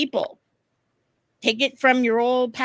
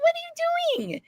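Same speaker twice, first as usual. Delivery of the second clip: mock disbelief. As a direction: squeal it in playful disbelief. Acts out reaction to his goofing.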